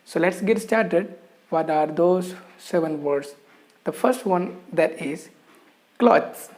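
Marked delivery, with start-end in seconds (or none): 6.0-6.5 clothes as one syllable, klohz; clothes said in one syllable, no extra vowel; correct pronunciation. Shown as a demonstration. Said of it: "there is only one syllable".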